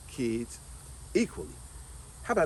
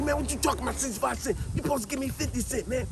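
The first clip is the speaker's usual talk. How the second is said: higher, whining complaint about the cents; childish indignant protest; 7 semitones higher than usual. Retold or imitated. Boy complains to his cousin about being shortchanged, indignant and higher. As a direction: kid arguing that he was shortchanged. Kid arguing with cousin over change.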